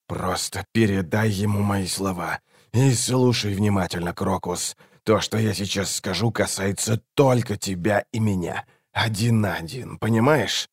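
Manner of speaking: restrained, confidential instruction; hushed assassin. A narrator's reading. Assassin tells the boy something private, hushed. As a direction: keep it close and quiet, just between two.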